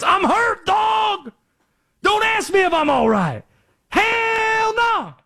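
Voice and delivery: high, strained yell imitating a hurt player; imitated yelling. Imitating a player yelling at his teammates, high shout. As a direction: wail it out at teammates, drawing out the words. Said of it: "yelling at his teammates".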